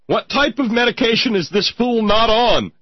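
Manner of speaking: high, loud, squeaky mimicry; mock helium voice. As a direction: squeal it like a mocking helium impression. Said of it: "Not helium, for sure".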